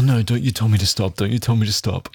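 usual speaking voice

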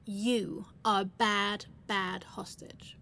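normal talking voice

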